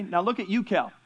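normal talking voice

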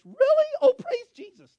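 very high, loud mimicked squeal; squeaky mock rejoicing; overjoyed receptionist. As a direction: imitate her over-happy cartoon-mouse outburst. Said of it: "That's what she said".

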